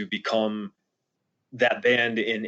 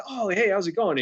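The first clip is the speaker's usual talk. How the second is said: cheery higher greeting; 10 semitones higher than usual. Retold or imitated. Jay greeting him cheerfully.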